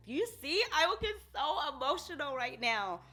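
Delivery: fighting back tears; getting emotional. Real, not acted. To viewers, on the edge of tears over a memory. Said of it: "Girl, don't get teary-eyed".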